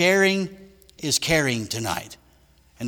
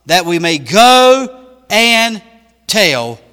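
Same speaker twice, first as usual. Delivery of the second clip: loud, ringing scripture reading, rising higher as it builds; preaching at full force; 8 semitones higher, 11 dB louder than usual. Acted. Preacher reading the lepers' verse to the congregation, full-force preaching.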